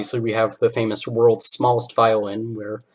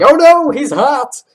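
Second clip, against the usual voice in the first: high, slow, loud swooning squeal; flustered squeal; 15 dB louder, slower than usual. Retold or imitated. Squidward gasping over a hot rival.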